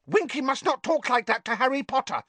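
high, scolding house elf; squeaky elf telling her off; angry elf voice. A narrator's reading. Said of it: "said Dobby angrily".

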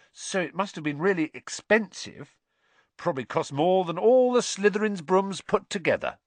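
high-pitched, pleased enthusiasm; happily. A narrator's reading. Boy delighted by his friend's costly broom, happily. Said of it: "said Ron happily".

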